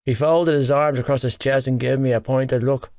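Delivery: usual speaking voice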